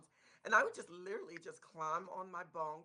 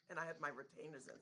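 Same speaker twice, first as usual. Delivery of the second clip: ordinary talk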